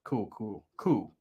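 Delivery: nervous cools. Retold or imitated. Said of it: "That's all I would always say".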